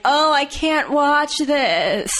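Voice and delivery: high, drawn-out mock squeamishness; hammed-up can't-bear-to-look whine; mock horror. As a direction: wail it slowly in pretend dread. Teasing, acting out friend's horrified reaction.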